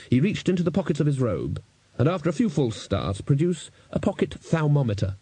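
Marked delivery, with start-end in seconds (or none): none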